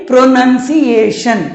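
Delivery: प्रोनंसिएशन slow, pro-nun-si-ay-shun; प्रोनंसिएशन drawn out with "nun" in the middle; correct pronunciation. Shown as a demonstration. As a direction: say प्रोनंसिएशन again slowly for the class. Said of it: "Say again".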